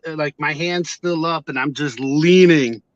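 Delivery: ordinary talk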